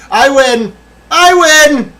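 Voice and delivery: high triumphant yell; victory shout. Real, not acted. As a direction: shout it out, thrilled.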